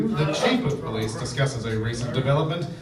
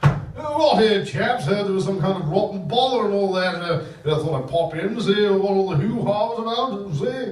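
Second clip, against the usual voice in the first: higher, louder blustering upper-class officer; posh old Major; 6 semitones higher, 5 dB louder than usual. Acted. Major barging into the chief's meeting, posh and blustery. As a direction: bluster in like a pompous old military man.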